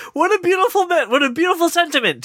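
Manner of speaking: high sarcastic gushing; mock awe. Real, not acted. Film critic mocking the ending in a high sarcastic voice. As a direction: gush with fake admiration.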